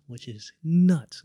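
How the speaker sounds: slow, loud, high burst of disbelief; amazed exclamation. Real, not acted. Telling a friend about being invited to her strict-parents house, drawn-out amazed exclaim. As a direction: stretch out how nuts it was.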